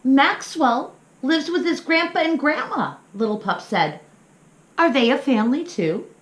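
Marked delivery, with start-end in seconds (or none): 0.0-3.0 put-on high, eager child voice; little pup voice. Little pup asking his mama, high and curious. 4.8-6.1 put-on high, eager child voice; little pup voice. Little pup asking his mama, high and curious.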